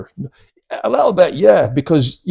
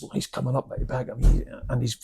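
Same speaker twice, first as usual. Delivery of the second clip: usual speaking voice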